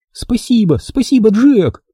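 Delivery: put-on high, delighted girl's voice; overjoyed thanks. A narrator's reading. Mia thanks Jack for agreeing to teach her, overjoyed. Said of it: "Мия так обрадовалась".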